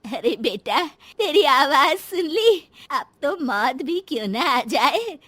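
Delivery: put-on wistful old mother, swelling with longing; emotional mother. Mother hearing her son's voice on the phone, emotional. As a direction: plead tenderly with a long-missed son.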